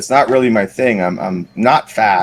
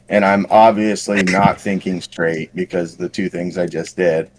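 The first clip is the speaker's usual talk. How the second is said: ordinary delivery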